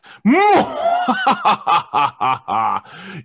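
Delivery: drawn-out villain cackle, rising; evil laugh. Acted. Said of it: "he lets out an evil laugh".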